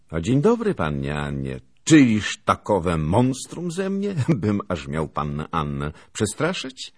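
lightly voiced gallant officer, loud opening; playful mock indignation, stretched at first; bantering lieutenant. A narrator's reading. Lieutenant greets the girl he has startled, bantering.

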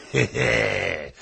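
slurred tipsy little laugh; drunken giggle. A narrator's reading. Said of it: "począł bełkocząc". (pijacki chichot)

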